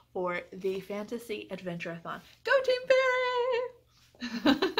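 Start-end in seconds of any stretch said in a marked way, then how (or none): 2.4-4.7 high, loud rallying cry; team cheer. Real, not acted. Readathon vlogger cheers on her team, high and loud. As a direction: shout it like a cheer for your team.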